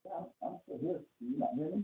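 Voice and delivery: distant, off-mic voice; speaking far from the microphone; from another room. Real, not acted. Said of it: "you sound like you're in another room".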